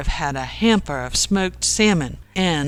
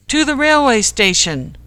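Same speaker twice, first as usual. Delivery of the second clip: raised, louder, rallying cry; animated shout; 7 semitones higher, 6 dB louder than usual. A narrator's reading. Joel rallying his friends to the station, animated shout. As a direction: shout it like an eager superhero. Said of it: "shouted Joel in a very animated fashion".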